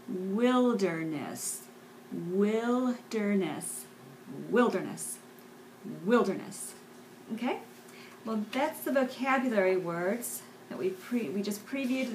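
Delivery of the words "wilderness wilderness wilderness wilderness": wilderness drawn out very slowly; slow teacher's demonstration of a word; slow syllable-by-syllable model. Shown as a demonstration. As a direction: stretch each syllable of wilderness.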